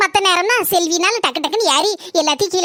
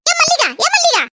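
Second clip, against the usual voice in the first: calling out; 10 semitones higher than usual. Acted. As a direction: call her name sharply to wake her from a daze. Calling to a dazed Mallika.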